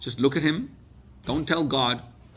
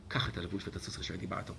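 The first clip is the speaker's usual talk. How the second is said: hushed urgent; 3 semitones lower, 9 dB quieter, much faster than usual. Retold or imitated. King's urgent order, hushed.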